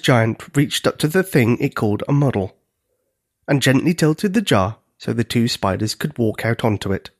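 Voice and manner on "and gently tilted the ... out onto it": ordinary delivery, nothing marked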